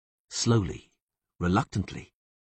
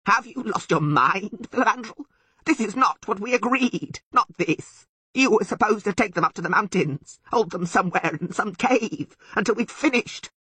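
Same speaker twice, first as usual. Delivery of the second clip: high, loud, indignant goat-like voice; bleating Sylvan; 14 semitones higher, 5 dB louder than usual. A narrator's reading. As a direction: bleat your outrage at the elf. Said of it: "the Sylvan suddenly bleated".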